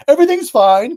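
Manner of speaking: loud, high-pitched mock positivity; false cheer. Warning against denying hard experiences, fake cheerful. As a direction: sing out a blatant cheerful denial. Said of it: "'cause that's a lie".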